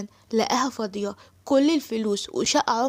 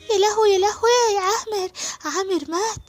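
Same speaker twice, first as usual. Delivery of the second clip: high, loud crying alarm; panicked wail; 9 semitones higher, 4 dB louder than usual. Acted. Suad believing Amer was shot, panicked crying wail. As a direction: wail in fright as if he died.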